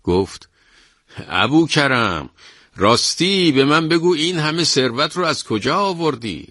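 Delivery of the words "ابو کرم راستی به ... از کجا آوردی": high, loud staged question; loud on purpose. A narrator's reading. Wife loudly asking her husband for the thief to hear, high staged call.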